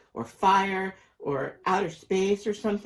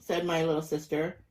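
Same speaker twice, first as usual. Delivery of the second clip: ordinary delivery, nothing marked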